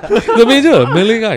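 very high, loud, cracking up; laughing in disbelief. Real, not acted. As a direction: squeal the punchline through laughter. Repeats punchline while laughing.

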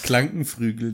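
"Krankenflügel" with l and r swapped; slip of the tongue. Shown as a demonstration. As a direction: say "Krankenflügel" as "Klankenfrügel". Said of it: "der sich ständig verspricht".

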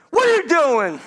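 high, loud prank yell; sudden yell. Retold or imitated. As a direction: burst out yelling in someone's ear. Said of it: "God don't have to yell in your ear".

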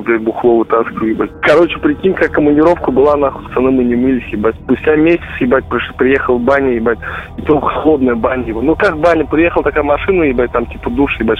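ordinary talk, nothing marked

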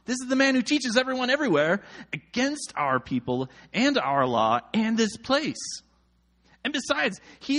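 high-pitched accusing read; mild shouted accusation. A narrator's reading. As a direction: accuse him high, without a full shout.

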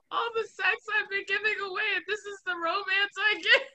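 high, loud, tearful wail; crying wail. Retold or imitated. Retelling crying when her best friend brought flowers, high tearful wail. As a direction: wail it through real tears, half laughing at herself. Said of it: "and I broke out in tears".

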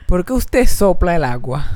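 mimicked baffled interrogation; incredulous question. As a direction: draw the question out, puzzled and accusing. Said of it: "esta fucking forma que ella me preguntó".

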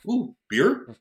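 light up at the offer of free beer; delighted "ooh, beer". Retold or imitated.

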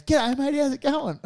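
high-pitched chirpy hello; cheery retold greeting. Acting the bloke's greeting.

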